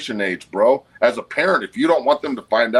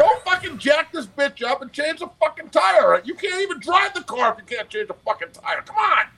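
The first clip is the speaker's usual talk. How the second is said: high-pitched incredulous tirade, words stretched out; mock outraged rant. Retelling yelling at a kid who called triple A, high mock outrage. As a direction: ham up the disbelief, way up in pitch. Retelling ranting at the young athlete.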